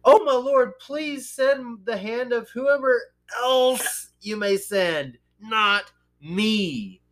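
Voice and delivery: high, slow, whiny plea; pleading Moses. Retold or imitated. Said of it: "you know, but he said".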